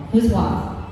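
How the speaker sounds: ordinary talk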